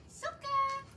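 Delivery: excited puppy call. Real, not acted. Trainer calling the puppy back, delighted. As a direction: call her in with rising joy.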